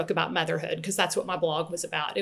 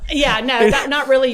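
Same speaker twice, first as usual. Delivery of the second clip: laughing; 5 semitones higher, 9 dB louder than usual. Real, not acted. Laughs at joke about blog.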